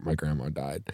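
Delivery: low, very soft mumble; mumbling. Pretend. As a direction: mumble it under your breath. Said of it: "you can mumble and be like".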